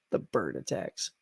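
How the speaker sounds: whispered, barely there; whispered aside. Real, not acted. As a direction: breathe it as a private aside. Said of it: "the bird attacks were actually pretty good".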